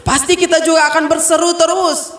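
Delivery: ordinary talk, nothing marked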